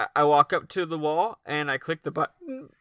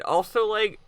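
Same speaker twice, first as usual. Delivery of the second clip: ordinary talk, nothing marked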